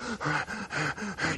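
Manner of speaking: a quick, hard gasp for air, soft; imitated sharp gasps. Lecturer imitating a pilot's breathing in combat, sharp gasps. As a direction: mimic a fighter pilot's rapid breathing. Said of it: "his breathing was like".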